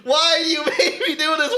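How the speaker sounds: mock martyr's cry; self-flagellating man. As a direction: wail it in mock torment, starting to laugh. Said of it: "just imagine a man just fucking whipping himself".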